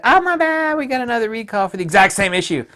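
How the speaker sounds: high, loud, fast breezy apology with a laugh; mock apology. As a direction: shrug it off cheekily. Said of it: "Nikon said".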